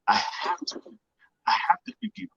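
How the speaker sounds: a near-whispered breath, then a high cracking plea; voice breaking. Retold or imitated. Son at his father's deathbed, voice breaking with emotion. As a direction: let the voice crack on the plea to forgive.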